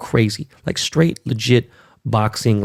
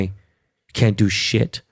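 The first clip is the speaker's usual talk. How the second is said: slow emphasis; much slower than usual. Real, not acted. Stressing helplessness.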